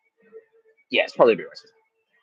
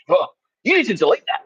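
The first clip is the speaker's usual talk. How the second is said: higher, louder indignant objection; retold indignant outburst; indignant demand; 10 semitones higher, 6 dB louder than usual. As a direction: imitate an affronted man demanding action. Retold man objects to being filmed.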